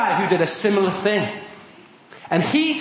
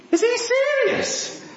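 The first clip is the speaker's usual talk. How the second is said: very high, drawn-out mock disbelief; incredulous; 14 semitones higher than usual. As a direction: squeak it slowly in offended disbelief. Fishermen react in disbelief.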